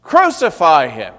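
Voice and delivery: very loud, high mob shout; the crowd's loudest shout. Acted. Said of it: "But they shouted all the louder".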